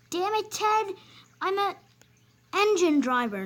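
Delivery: high, loud frustrated shout; angry outburst. Acted. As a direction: bark it at him. Driver exploding at Ted.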